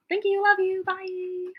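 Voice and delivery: high, chirpy farewell; sing-song goodbye. Real, not acted. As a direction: call bye up high. Calling to son.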